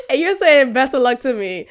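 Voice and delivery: real laughter breaking through; laughing. Real, not acted. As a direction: laugh while repeating it. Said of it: "I'm crying".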